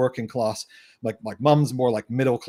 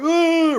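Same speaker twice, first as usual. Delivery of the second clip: high, held "ooh"; drawn-out high mock hoot; imitated crowd "ooh". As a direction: mimic locals going "ooh" in a high pitch. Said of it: "people in Bristol are like".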